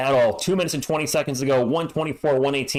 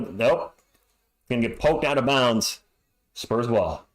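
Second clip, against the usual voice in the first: usual speaking voice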